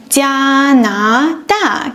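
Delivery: 加拿大 said slowly and carefully; slow model word. Shown as a demonstration. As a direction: model the country name slowly. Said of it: "For instance".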